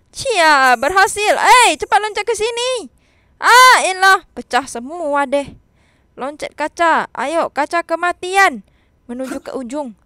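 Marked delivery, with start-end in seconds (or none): none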